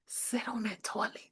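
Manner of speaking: whispered tip to the viewers. Real, not acted. Telling pregnant viewers the toilet secret, whispered. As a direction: breathe it like a secret.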